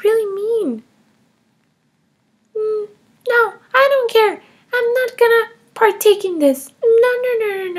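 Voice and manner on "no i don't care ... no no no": put-on higher, defiant little girl voice; child Emma. Kindergartner Emma defending taking her mom's money, higher child voice. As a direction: play a cheeky kindergartner justifying herself.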